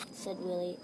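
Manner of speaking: ordinary delivery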